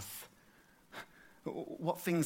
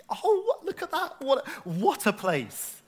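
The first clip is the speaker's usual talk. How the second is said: high-pitched delighted outburst; excited gushing; 12 semitones higher, 5 dB louder, slower than usual. Real, not acted. Preacher showing the congregation his local high street, excited gushing. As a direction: gush over it, starting very high and easing down.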